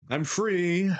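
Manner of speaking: I'm pitched high in triumph; gleeful relief. Real, not acted. Streamer freed from the voice, high and gleeful. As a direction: sing out your freedom. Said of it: "relief after voice ends".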